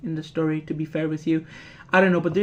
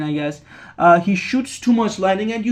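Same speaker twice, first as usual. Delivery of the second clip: usual speaking voice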